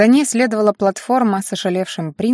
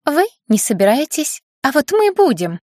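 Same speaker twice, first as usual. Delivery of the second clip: triumphant queen; 7 semitones higher than usual. A narrator's reading. Queen gleefully overrules refusal.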